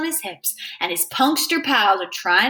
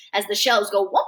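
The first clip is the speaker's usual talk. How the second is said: ordinary delivery, nothing marked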